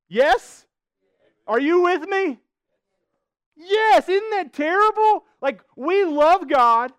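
loud, high-pitched appeal to the congregation; rousing call-out. Real, not acted. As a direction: rouse the room for an answer, voice way up.

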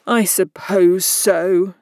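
slow, sulky reluctance. A narrator's reading. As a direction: grudgingly drag the words out. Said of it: "the other sulkily replied".

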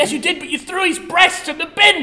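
high, loud accusation; hammed-up angry complaint; mock outrage. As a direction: shout the accusation in a pretend row. Mock quarrel with the doctor.

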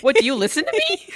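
high, loud exasperated shout; exasperated yell. Retold or imitated. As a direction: shout it like you can't believe her. Acted exasperated quote.

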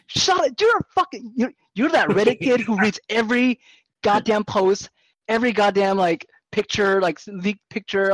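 high, loud rant that slows at the end; mock-furious rant at the fan; retold angry rant. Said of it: "It's just like".

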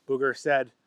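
plain reading voice

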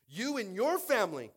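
loud, high prophet's rebuke; acted accusation. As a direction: hurl it as Elijah accusing the king. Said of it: "would like to be talked to that way".

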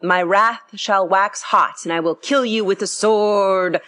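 slow, loud, booming pulpit roar; preacher bellowing. A narrator's reading. Preacher next door bellowing scripture through the wall, slow booming roar. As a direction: bellow scripture like a blatant exhorter. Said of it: "when he bellowed".

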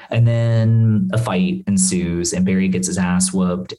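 ordinary delivery

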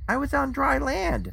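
high-pitched indignant retort; incredulous protest. A narrator's reading. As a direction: let the voice shoot up in disbelief. Narrator protests the absurd excuse.

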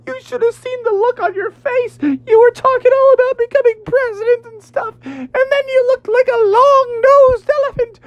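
very high, cackling cartoon eel; mock him through high-pitched laughter; gleeful falsetto teasing; laughing taunt. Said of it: "he started laughing".

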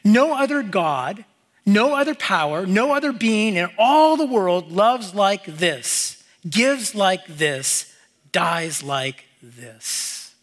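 slow and weighty, slowing to a near stop on the last words; slow solemn quote reading. Shown as a demonstration. Preacher reads the author's words to the congregation, slow and solemn. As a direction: read the quote slowly, letting each line sink in. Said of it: "he goes on and he says this".

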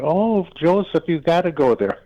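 high, excited mother voice gushing over the priest's promise. Retold or imitated. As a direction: mom giddy with joy, pleading with her son.

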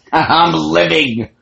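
loud, slow, high victory cry; triumphant shout. Retold or imitated. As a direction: savour it, loud and drawn out. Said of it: "And she's".

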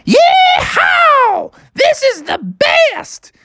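very high shouted whoop; exhilarated Bubby. Acted. Bubby splashing through the river, exhilarated. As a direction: squeal it out, stretching the end.

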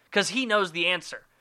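normal talking voice

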